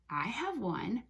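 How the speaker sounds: usual speaking voice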